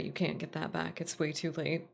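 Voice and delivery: ordinary delivery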